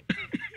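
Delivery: a short high giggle; high laugh. Real, not acted. Host giggling at her own question to the co-host, high giggle. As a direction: giggle at the thought.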